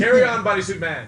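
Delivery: loud, cheery boss impression; Steinbrenner quote. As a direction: bellow it like a proud owner.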